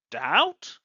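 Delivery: "doubt" said alone, rising; questioning rise. Shown as a demonstration. Teacher echoing the word with a rising tune. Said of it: "to express doubt".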